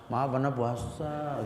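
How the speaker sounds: slow, meek little apology; weak apology. Shown as a demonstration. Preacher showing the wrong, timid reply to a provoker, slow and meek. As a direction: shrink back as you say it.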